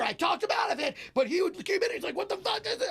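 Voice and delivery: high-pitched exasperated rant, imitated; manager losing his mind. Manager tells comic he talked George down, mock frantic rant. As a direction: keep the frantic manager's tirade going. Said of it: "he is losing his mind".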